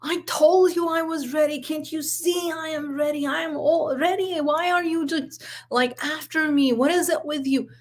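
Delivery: put-on high-pitched, exasperated teen rant; imitated angry outburst. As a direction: mimic a son flipping out at his mother. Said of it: "He flips and he's like".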